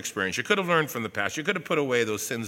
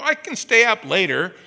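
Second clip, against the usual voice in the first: put-on kid asking to stay up; 8 semitones higher, 10 dB louder than usual. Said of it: "I go".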